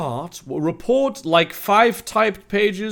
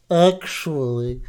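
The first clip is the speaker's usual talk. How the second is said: lightly voiced God, slow and a bit low; a hint of a lazy God, drawn out; 6 semitones lower, slower than usual. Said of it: "I'm gonna do God now".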